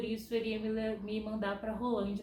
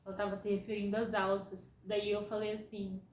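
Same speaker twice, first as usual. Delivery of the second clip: ordinary talk, nothing marked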